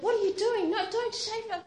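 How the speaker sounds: high, loud frantic protest; panicked cry. Retold or imitated. Johnny cries out as the nurse shaves her hair, high frantic protest. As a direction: shout at them to stop.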